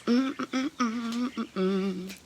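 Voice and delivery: high little hummed bops; happy dance hum. Pretend. Speaker doing a happy dance, playful high humming.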